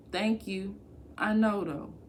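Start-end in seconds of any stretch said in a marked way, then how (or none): none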